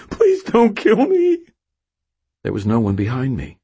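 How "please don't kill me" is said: high, slow frightened plea; pleading in fear. A narrator's reading. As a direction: beg slowly, terrified. Cashier begging.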